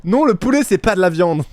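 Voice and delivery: high, loud mock protest; imitating indignant contestant. Mimicking a contestant insisting chicken isn't meat, mock indignation. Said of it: "Ça me rappelle la meuf ... qui dit".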